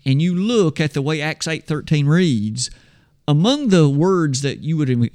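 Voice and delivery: usual speaking voice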